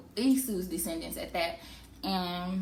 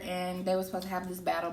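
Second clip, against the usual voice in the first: normal talking voice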